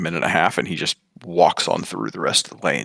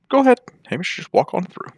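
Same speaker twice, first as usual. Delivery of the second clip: high urging; 12 semitones higher, 4 dB louder than usual. Acted. Grandfather urges Hamish on.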